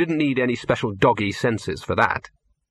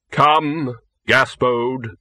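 Death, slow and grave; 4 dB louder, much slower than usual. Acted. Death calling.

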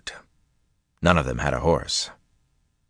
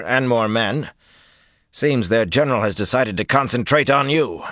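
higher, urgent military report; officer's raised, insistent voice; battle-worn officer, emphatic; 7 semitones higher, 4 dB louder than usual. A narrator's reading. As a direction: report the enemy's numbers forcefully to your leader.

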